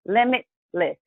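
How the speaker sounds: LIMITLESS broken in two, a pause before the last syllable; split into two words. Shown as a demonstration. Host spelling out a theme for viewers, split into two words. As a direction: say Limitless as two separate beats. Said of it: "it sounds like one word, but".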